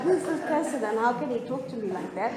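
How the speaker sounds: mock outrage through a laugh; amused, half-joking indignation. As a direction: laugh while retelling the insult.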